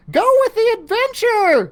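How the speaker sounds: high, loud shout full of glee; giggly shout. A narrator's reading. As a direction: whoop it out with a giggle. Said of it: "Susie shouted with a giggle".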